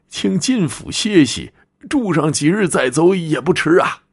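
put-on kindly old host, insistent. Old man urges the tired traveller to stay, insistent elderly voice. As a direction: coax the guest as an old man, pitch swooping up.

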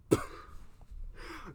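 wheezing silent laughter; real silent giggle fit; breathy silent laugh. Real, not acted. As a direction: laugh without voice, helpless. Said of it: "This is so funny".

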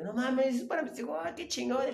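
high, excited disbelief, half laughing; surprised self-quote. Retold or imitated. Telling a friend how he reacted to his birthday surprise, high and amazed. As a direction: squeal of happy surprise.

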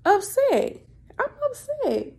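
upset pitch jumps high. Real, not acted. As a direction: voice climbing high as the anger builds. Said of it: "I'm actually".

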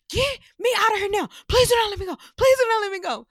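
high, loud imitation of frantic pleading; panicked yell. Imitating friend freaking out in kayak, yelled demand. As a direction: shriek the plea like a panicking friend. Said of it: "when she yells".

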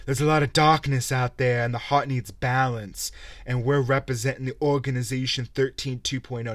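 a hint of a solemn cult leader; mock villain speech. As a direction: parody a pompous RPG villain's speech. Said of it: "they're like".

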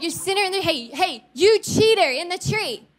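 high, scolding public call-out; mock call-out. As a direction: lay into him in front of the crowd. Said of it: "he could have said".

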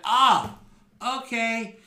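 put-on high drunken whine. Skruldar reacting to his loss.

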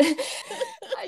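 quick high delighted giggle; a bright burst of laughter. Real, not acted. Host laughs before praising guest's voice, high giggle.

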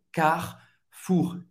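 Carrefour as two syllables, slow; no middle E. Shown as a demonstration. Teacher shows dropping the middle E. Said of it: "não vai pronunciar o E no meio".

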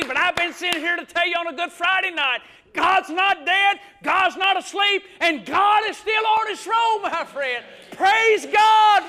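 high, loud, building to a shout; full-force preaching. Real, not acted. Preacher telling church God's not dead, building to shouted praise. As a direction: hammer each phrase as a rising proclamation.

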